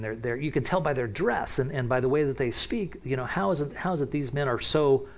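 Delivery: usual speaking voice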